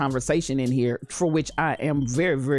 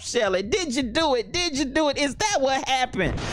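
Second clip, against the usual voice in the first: normal talking voice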